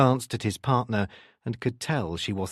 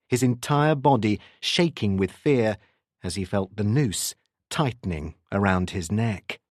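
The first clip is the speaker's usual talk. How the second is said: ordinary delivery, nothing marked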